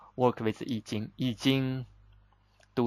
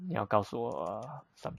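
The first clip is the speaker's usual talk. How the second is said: normal talking voice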